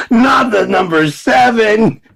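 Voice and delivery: very high, loud, slow wail; mock terror. Dreading a named punishment, very high mock-terrified wail. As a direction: wail like a condemned man.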